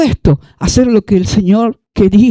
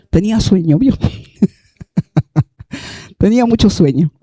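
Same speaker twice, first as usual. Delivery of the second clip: usual speaking voice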